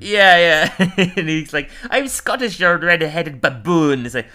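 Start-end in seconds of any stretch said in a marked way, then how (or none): 1.9-4.1 put-on high-pitched Scottish accent; cartoon Scotsman, high and indignant; Willie, Scottish accent. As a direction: put on a hammed-up Scottish brogue. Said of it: "and then Willie comes over".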